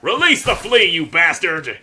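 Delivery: loud, high yell at an enemy; battle shout. Real, not acted. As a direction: yell it at the bug. Fighting creature holding flea.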